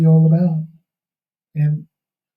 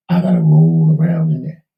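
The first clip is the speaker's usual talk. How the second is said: ordinary talk, nothing marked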